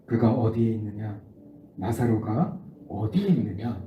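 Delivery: low, grave voice of Jesus; Jesus asking. Acted. Jesus asks the mourners where Lazarus lies, low and grave. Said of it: "그리고 말해요".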